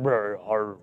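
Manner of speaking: imitated rumbling stomach growl, low. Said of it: "it'll be like".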